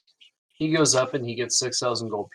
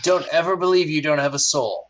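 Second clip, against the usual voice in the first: higher first mate voice, earnest; 6 semitones higher, 3 dB louder than usual. Acted. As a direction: reassure the rogue about his soul. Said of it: "He goes".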